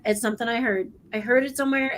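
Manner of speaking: normal talking voice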